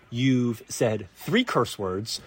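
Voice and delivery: ordinary talk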